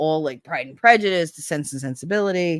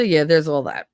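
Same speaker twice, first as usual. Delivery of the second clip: usual speaking voice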